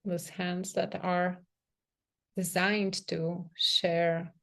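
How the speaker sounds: soft, unhurried meditation voice with long pauses; guided meditation. Real, not acted. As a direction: gently guide them into sensing the body, leaving space between phrases. Leads a guided self-touch meditation.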